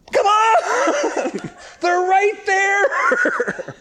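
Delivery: hammed-up indignant Jonah, cracking up; laughing mock shout. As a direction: howl it in mock outrage, laughing. Jonah protesting the city survives.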